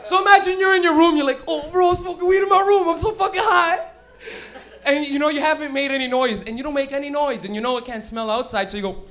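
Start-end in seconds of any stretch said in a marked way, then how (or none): 1.5-3.9 high, loud stoner boast; excited stoner. Retold or imitated. Imagined stoner alone in his room, high and loud. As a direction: crow it loudly, pitched way up.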